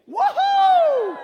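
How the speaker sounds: very high, very loud shouting; wild cheering. Pretend. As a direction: celebrate like a wild fan. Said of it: "I think he does this".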